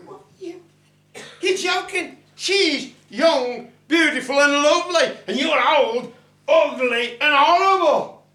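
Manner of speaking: high, loud incredulous outburst; mock him, high and outraged; high indignant voice. Man mocking the old leprechaun, high and loud.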